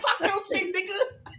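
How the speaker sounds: laughing squeal. Retold or imitated. Retelling his reaction to the promo, high and laughing.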